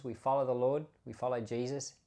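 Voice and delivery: ordinary talk, nothing marked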